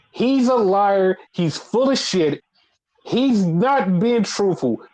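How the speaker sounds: retold promo rant, pitched high and emphatic; imitated heated warning. Retelling Seth Rollins's warning to Adam Pearce, heated and high-pitched.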